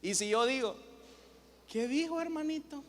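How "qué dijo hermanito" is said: high, soft little-voice question; high and soft, as if to a little one; sweetly put-on tone. Shown as a demonstration. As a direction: ask it in a raised, gentle put-on voice. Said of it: "interprete mi tono de voz".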